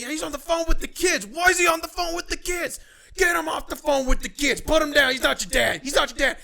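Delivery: loud, high-pitched mock tirade; heated shouting. Boss ordering him off the phone with the kids, heated. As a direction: yell orders in an imitated outburst. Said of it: "he was getting heated".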